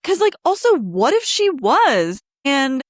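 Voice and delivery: heated rant. Real, not acted. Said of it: "I could go off on this".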